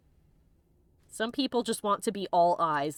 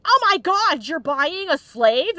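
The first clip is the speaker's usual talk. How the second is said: louder and higher, dragging out the shock; mock shocked outburst; 6 semitones higher, 8 dB louder, slower than usual. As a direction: gasp it out in hammed-up disbelief. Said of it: "he whipped around and went".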